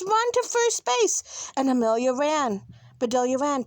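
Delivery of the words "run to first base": high-pitched urging cry; excited, high team yell; yelled instruction. A narrator's reading. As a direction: call the runner on to the base. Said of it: "yelled the boys".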